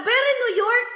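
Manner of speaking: a sneering put-on sosyal New York voice; mocking very New York. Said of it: "eto yung very New York na umaarte". (nanunuyang boses na pa-New York)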